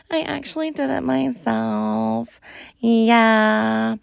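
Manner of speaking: lightly voiced Julia Fox drawl, slow; a hint of Julia Fox, slow and smug; Julia Fox impression. Host doing a quick celebrity impression, light Julia Fox voice. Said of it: "Julia Fox impersonation".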